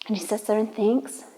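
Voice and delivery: ordinary delivery, nothing marked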